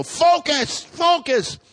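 high urgent repeated urging; urgent coaching. Retold or imitated. Husband coaching wife through contractions, high urgent. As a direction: push her to focus, high.

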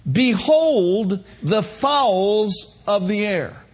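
slow, weighty scripture reading; slow solemn reading of scripture. Acted. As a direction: read it like holy writ, drawn out. Preacher reading scripture slowly.

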